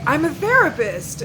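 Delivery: high, loud mock announcement of his job; quoted man's reveal. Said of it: "and he's like".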